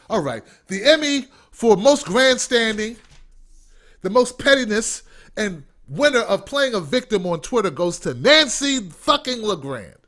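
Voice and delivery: loud, mocking Emmy presenter; mock award announcer. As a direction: announce a sarcastic award to the crowd, loudly.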